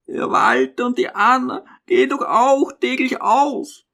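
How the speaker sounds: put-on high, feeble old mother; timid, high-pitched objection; weak resistance. A narrator's reading. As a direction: argue back weakly in a high voice. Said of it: "ihr schwacher Widerstand war noch nicht völlig gebrochen".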